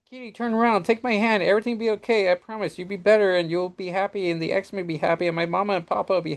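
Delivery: put-on high little-boy voice, earnest and promising; a small boy reassuring his friend; child voice. Child Franklin voiced from comic.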